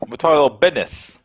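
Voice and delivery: a hammed-up North Jersey Italian accent, leaning on "business" as "bidness"; a mock wiseguy from North Jersey; North Jersey Italian mobster. Co-host teasing the business-of-hockey theme in a mock North Jersey Italian accent. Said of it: "that North Jersey Italian slang".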